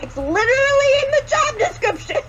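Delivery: very high, hammed-up comeback; mock exasperation. Friend replaying his comeback for laughs, hammed-up exasperation.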